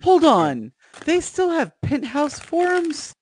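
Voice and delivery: usual speaking voice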